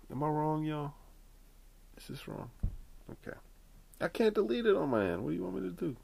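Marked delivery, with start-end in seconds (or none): 2.0-3.4 soft whispered question. Real, not acted. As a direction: whisper it to the audience. Sheepish aside to audience.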